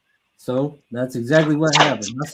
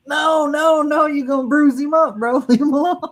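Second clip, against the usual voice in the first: high, loud laughing holler of no, no; mock yell; 10 semitones higher, 5 dB louder than usual. Telling buddy's son to stop drop-kicking the chicken, high mock yell. Yelling at kid kicking rooster.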